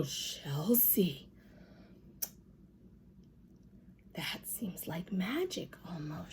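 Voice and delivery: soft, hushed wonder; awed whisper. A narrator's reading. Lucille whispering in awe to Sheldon.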